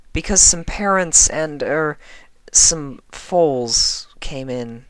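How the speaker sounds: slow, pained admission; wincing. A narrator's reading. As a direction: drag out the embarrassing part. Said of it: "Twilight continued with a wince".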